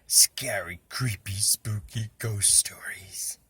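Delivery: slow, eerie ghost-story whisper; spooky whisper. A narrator's reading. Reggie whispering slowly to spook his friend about ghost stories. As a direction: try to give him the creeps.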